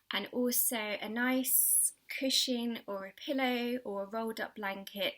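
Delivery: normal talking voice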